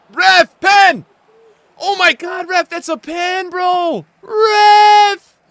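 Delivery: loud, high shouting at the ref; outraged appeal. Real, not acted. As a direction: plead furiously for the penalty. Demands a penalty from ref.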